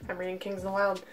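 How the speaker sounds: hushed, worn-out talk at night; tired and sore. Real, not acted. Updating her viewers at night in pain, hushed and tired.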